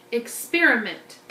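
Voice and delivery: ordinary talk, nothing marked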